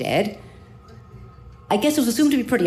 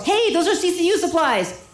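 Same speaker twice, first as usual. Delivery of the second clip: nurse yelling; 9 semitones higher than usual. Retold or imitated. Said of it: "just as the nurse yells".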